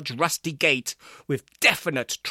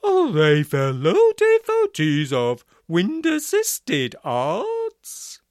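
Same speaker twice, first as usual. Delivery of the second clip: louder, slow trombone-man boom; 5 semitones higher, 6 dB louder, slower than usual. A narrator's reading. Barnstable asks Floriati in his trombone voice. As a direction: boom like a brass instrument. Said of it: "the trombone voice continued".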